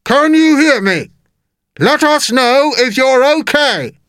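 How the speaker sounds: high, slow, drawn-out calling; shouted. A narrator's reading. Police officer outside checking on Jake, high drawn-out shout. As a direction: call slowly and high across a distance. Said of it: "shouted one of the police officers".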